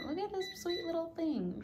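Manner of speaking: high, soft cooing over a puppy. Real, not acted. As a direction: coo softly at the sleeping puppy.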